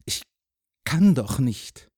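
put-on hesitant boy with a broken start; falter, then pitch up anxiously; uncertain, halting excuse; unsure. A narrator's reading. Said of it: "sagte Jürgen unsicher".

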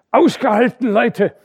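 loud rallying shout; shouting encouragement. A narrator's reading. The dike reeve urging the workers below, shouting. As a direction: yell down to keep them going. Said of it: "schrie er denen unten hinab".

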